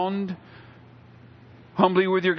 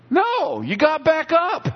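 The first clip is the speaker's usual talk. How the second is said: emphatic rebuttal; 11 semitones higher, slower than usual. Real, not acted. Preacher answering the quitter, high and emphatic. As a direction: punch it out to the congregation.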